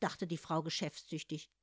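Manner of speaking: ordinary delivery, nothing marked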